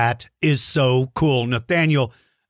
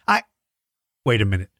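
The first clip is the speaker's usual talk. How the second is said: ordinary talk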